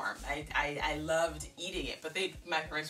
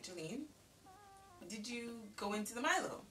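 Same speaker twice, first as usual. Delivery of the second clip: hint of a stern dad; 4 semitones higher, 10 dB quieter than usual. Dad questioning her, voiced in story.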